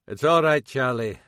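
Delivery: normal talking voice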